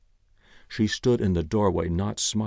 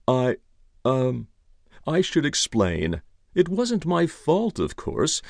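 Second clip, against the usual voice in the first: higher-pitched, flustered explanation; embarrassed explaining; 6 semitones higher, 4 dB louder than usual. A narrator's reading. Ira explaining the back door to police, embarrassed. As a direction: stumble in, then explain yourself in a higher voice. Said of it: "cleared his throat in embarrassment".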